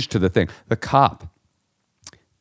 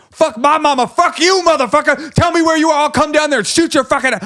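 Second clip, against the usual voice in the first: very high, loud furious screaming; cop snapping; 4 dB louder than usual. Retold or imitated. Unflappable cop finally losing it, screaming threats at the caller. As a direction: explode in rage at the caller. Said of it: "The cop also, who's been unflappable".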